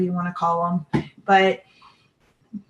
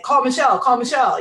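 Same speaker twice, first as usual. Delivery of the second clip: higher, louder, repeated insistently; urgent prompting; 5 semitones higher, 5 dB louder, faster than usual. Retold or imitated. Spirits telling mom to call Michelle, urgent. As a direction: imitate an insistent nudge in someone's ear.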